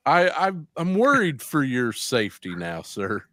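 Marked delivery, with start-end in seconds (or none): none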